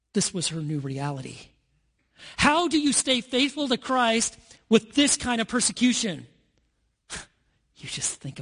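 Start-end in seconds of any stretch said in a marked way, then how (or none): 2.4-6.3 raised, higher rhetorical question; preacher's cry. Real, not acted. Preacher challenging the congregation, raised and high. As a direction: call it out to the congregation.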